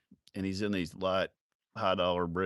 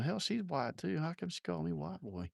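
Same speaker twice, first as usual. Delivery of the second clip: high, hushed inner voice; soft, high-pitched mock bafflement; mock puzzled thought; 9 semitones higher, 7 dB quieter than usual. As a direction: wonder to yourself in a small high voice. Retold thought after the insult.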